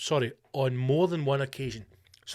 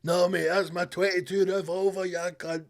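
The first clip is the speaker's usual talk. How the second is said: hard-man threat; 3 semitones higher than usual. Retold or imitated. Voicing owner again.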